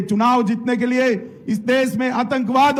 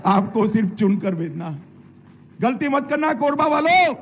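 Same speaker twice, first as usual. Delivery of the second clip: ordinary talk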